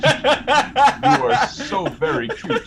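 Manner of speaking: put-on high cooing baby talk, laughing.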